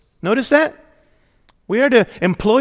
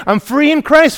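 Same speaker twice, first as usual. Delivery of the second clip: mocking freedom boast; 6 semitones higher, 5 dB louder than usual. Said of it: "People talk about freedom".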